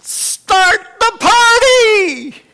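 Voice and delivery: huge, slow, high shout of joy; father calling out to servants, loud and high; joyful yell. Retold or imitated. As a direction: bellow it like a father overjoyed. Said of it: "yells at the servants".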